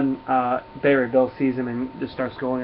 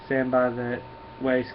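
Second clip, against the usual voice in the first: ordinary talk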